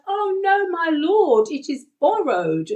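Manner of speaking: high-pitched, dramatised cry of panic and shame; panicked cry. Acted. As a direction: cry out desperately as the distraught young prophet. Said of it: "Can you hear the panic in his voice".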